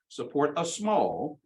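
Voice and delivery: slow down and press on "small"; stress on small. Shown as a demonstration. Lecturer to students, slowly stressing "small" in the tax plan. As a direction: draw out "small" slowly to shrink it. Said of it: "emphasis on small".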